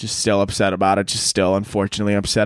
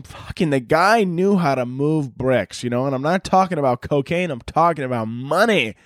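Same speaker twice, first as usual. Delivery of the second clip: ordinary talk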